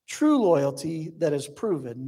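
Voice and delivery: ordinary talk, nothing marked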